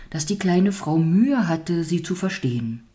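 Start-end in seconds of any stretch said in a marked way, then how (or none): none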